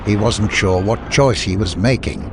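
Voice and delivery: ordinary delivery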